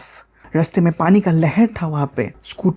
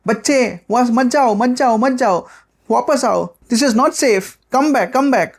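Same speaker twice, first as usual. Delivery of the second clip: put-on high-pitched aunty calling a child back; urgent warning call; 7 semitones higher, slower than usual. Aunties warn the boy off the flood water, urgent high call.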